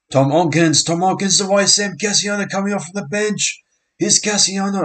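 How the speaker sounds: high, loud, rising call of the break; excited live call of the play; excited commentary. Real, not acted. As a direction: call the run as it happens. Sudden attacking break in live play.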